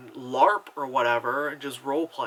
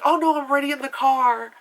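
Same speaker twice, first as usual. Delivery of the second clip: high, loud mimicry of a clueless passenger; mock oblivious passenger; 11 semitones higher, 8 dB louder than usual. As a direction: mimic an oblivious woman waving off a caller. Mimics oblivious woman on phone.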